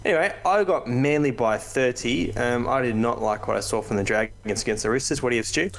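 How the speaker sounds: normal talking voice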